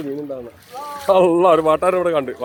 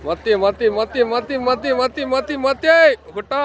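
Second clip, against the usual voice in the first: rising sing-song call; 9 semitones higher, 3 dB louder, slower than usual. Acted. മത്തി എന്ന് ആവർത്തിച്ചു വിളിക്കുന്നു.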